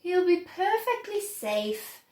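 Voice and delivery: ordinary delivery, nothing marked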